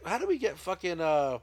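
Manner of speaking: usual speaking voice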